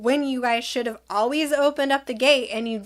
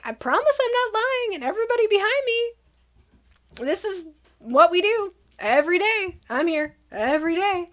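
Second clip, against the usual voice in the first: pleading; 7 semitones higher than usual. Retold or imitated. Pleading with yelling staff member.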